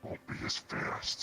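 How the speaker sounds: usual speaking voice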